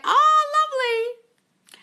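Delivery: very high, loud, drawn-out delight; long gushing squeal. Real, not acted. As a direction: coo loudly and high at lovely news. Delighted at a follower's hummingbird story.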